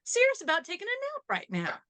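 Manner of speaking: very high put-on sitcom voice; quoted secretary parody. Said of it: "I'll be like".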